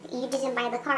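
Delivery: a hint of a curt office lady; imitating clerk.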